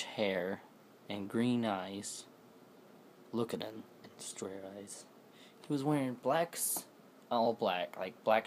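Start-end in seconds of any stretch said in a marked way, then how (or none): 3.3-5.0 breathy spooky whisper; eerie storytelling whisper. Acted. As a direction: hush it like a secret warning.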